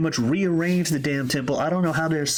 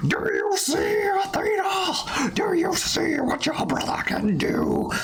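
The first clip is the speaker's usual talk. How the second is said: high, drawn-out taunting cry; hammed-up villain yelling upward; mock yell at the sky; 13 semitones higher than usual. Ares yelling at the sky to Athena, high mock yell. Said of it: "yelling at the sky".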